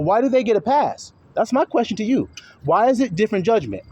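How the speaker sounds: ordinary delivery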